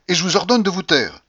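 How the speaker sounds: high, loud angry command; indignant. A narrator's reading. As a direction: order it with offended authority. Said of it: "dit-elle avec indignation".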